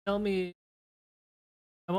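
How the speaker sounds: ordinary delivery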